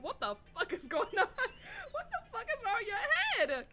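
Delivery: real laughter through the words; stunned player laughing at the game; laughing disbelief. Real, not acted. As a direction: laugh while asking in shock. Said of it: "reacts laughing to scene".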